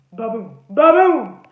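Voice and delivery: loud, slow heartbeat sound; pounding heartbeat. Acted. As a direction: thump out a racing heart. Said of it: "my heart went ba-boom".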